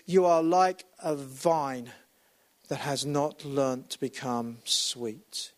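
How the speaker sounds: slow, fading scripture quote; slow solemn quote. Retold or imitated. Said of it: "He says".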